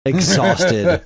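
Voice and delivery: laughing while talking; words bubbling with laughter. Real, not acted. Laughing about exhausted dog.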